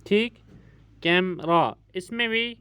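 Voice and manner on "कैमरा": कैमरा split as कैम, pause, रा; syllable break. Shown as a demonstration. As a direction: say कैमरा in two separate beats. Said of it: "दो syllable हैं". (कैमरा को तोड़कर, कैम... रा)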